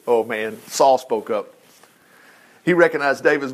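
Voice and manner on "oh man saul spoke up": ordinary talk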